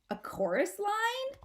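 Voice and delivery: thrilled little possum; excited. A narrator's reading. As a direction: Angie asking her mother excitedly. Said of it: "shouts with her trademark excitement".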